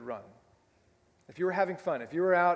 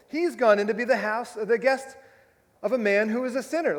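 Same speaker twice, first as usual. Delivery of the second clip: higher, louder mock grumble of the crowd; grumbling crowd; 6 semitones higher, 6 dB louder than usual. Preacher voicing the crowd grumbling about Jesus' host, mock indignant. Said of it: "they all grumbled".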